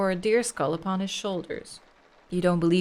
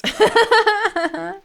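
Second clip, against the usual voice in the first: loud laugh; 12 semitones higher, 9 dB louder than usual. Acted. Comic laugh at getting lost.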